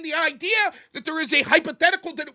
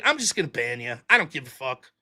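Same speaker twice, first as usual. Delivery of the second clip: much lower, softer deadpan; weary dismissal; 12 semitones lower, 4 dB quieter than usual. Retold or imitated. Telling viewers how he bans trolls, low deadpan. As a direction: drop the voice low and shrug it off. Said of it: "So now I'm just like".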